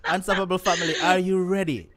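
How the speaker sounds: hyping up the show's audience; rallying the audience. Real, not acted. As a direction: call out to rouse the listeners. Host rallies the audience.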